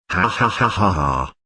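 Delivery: a lightly voiced chuckle, ha ha; laughing. A narrator's reading. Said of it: "Ron laughed".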